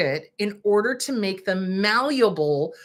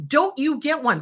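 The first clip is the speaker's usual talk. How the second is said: ordinary delivery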